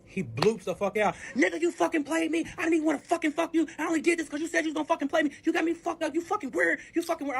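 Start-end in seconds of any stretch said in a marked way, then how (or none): 1.3-7.4 high-pitched accusing rant; heated, indignant imitation of an outburst; acted angry blow-up. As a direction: lash out in a much higher voice. Acted blow-up over the money.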